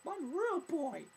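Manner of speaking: very high, loud falsetto; mock squeaky boy. Mocking people who claim to be real, squeaky falsetto. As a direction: squeal it out in a put-on little voice.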